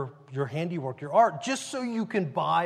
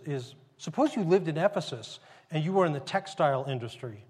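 normal talking voice

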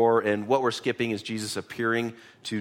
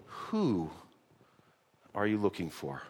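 soft, intimate question; tender; 4 semitones lower, 8 dB quieter than usual. Acted. Jesus quietly asks Mary whom she seeks, intimate and soft. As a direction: ask it quietly, as a personal call.

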